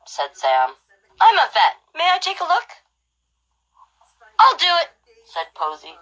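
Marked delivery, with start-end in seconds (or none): 1.2-2.8 put-on child vet voice. 4.4-4.9 put-on bossy girl voice. Posey grabs the task.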